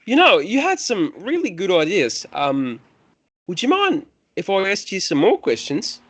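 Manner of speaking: high, lilting, polite priest voice; ingratiating temple priest. Acted. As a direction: coax the visitor along in a high voice.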